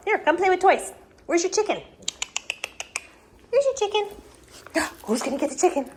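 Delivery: high eager coaxing; excited puppy talk. Real, not acted. Owner coaxing puppies toward the squeaky chicken, high and eager. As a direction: tease them toward the toy.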